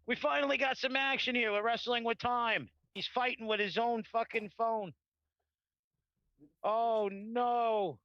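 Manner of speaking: frantic mock sports commentary. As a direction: shout it like a ringside announcer counting. Host mock-calls his phone struggle.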